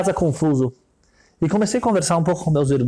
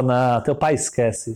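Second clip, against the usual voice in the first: low, slow, dismissive rich man; rich man's voice; 7 semitones lower, slower than usual. Retold or imitated. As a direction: brush off the kid's father from down low.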